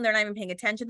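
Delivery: normal talking voice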